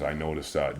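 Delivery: ordinary delivery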